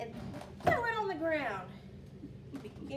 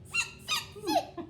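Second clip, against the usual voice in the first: very high squeaky monkey voice; monkey chatter; 6 dB louder, slower than usual. Acted. Monkeys mock the peddler back in high squeaks. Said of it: "the monkeys only shook their fingers back at him and said".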